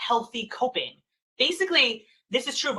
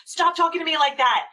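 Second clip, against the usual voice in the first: mock scolding; 8 semitones higher, 4 dB louder than usual. Parent scolding child in yuck.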